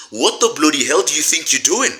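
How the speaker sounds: hammed-up British telling-off, chummy then outraged; mock British accent. Talking to Cyril, a mock British accent telling-off. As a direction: scold Cyril like a cheeky British bloke. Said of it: "in the most British way I possibly can".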